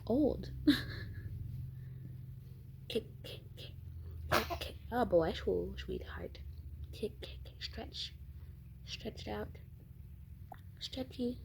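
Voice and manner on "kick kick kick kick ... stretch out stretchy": whispered sing-song encouragement; soft whispered coaxing. Real, not acted. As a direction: coax softly under the breath. Encouraging baby to kick.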